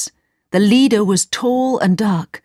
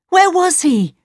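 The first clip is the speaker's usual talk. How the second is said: high-pitched, anxious question; urgent cry; 11 semitones higher than usual. A narrator's reading. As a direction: cry it out, desperate to know. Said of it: "cried Gerda".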